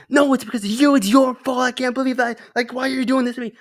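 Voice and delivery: hammed-up upset rant in a high put-on voice; mock angry texting. His younger self blaming a friend, high whiny mock anger. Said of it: "I was like".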